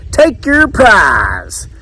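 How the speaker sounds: high, long-drawn barker call; fair caller. A narrator's reading. Caller handing Edwards a prize, high drawn-out call. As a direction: sing out the award, stretching it.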